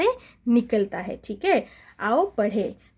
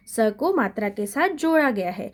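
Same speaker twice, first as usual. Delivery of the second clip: ordinary delivery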